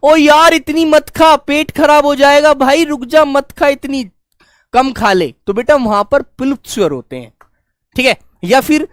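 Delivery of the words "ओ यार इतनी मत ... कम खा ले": louder and higher, pleading with a friend; calling out. Retold or imitated. Teacher acting a friend stopping a jalebi binge. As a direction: call out to stop him eating. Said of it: "तुम क्या कहोगे".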